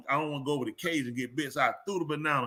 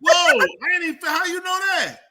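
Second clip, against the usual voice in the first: much higher, much louder startled whoa; mock shock; 13 semitones higher, 9 dB louder than usual. Caught out by the bed remark, in high loud mock shock. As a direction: recoil in mock surprise. Surprised exclamation, high far voice.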